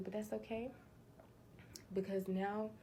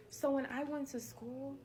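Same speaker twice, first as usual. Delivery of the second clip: ordinary talk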